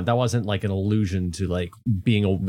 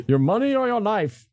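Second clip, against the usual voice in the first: mock highwayman; 11 semitones higher than usual. Joking highwayman threat.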